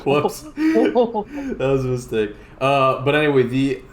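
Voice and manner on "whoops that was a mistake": slow, high, laughing through the words; real laughter at a botched line. Real, not acted. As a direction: giggle it out at your own mistake. Laughing at own drawing slip.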